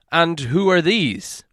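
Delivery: a lightly voiced wary captain; guard captain. A narrator's reading. Captain questions Thorin.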